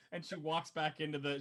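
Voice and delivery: ordinary delivery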